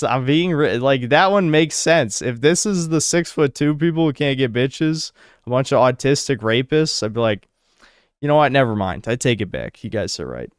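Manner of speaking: normal talking voice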